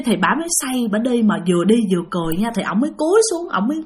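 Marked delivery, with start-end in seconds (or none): none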